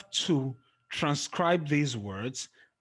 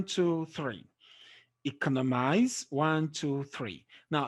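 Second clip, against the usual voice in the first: ordinary talk, nothing marked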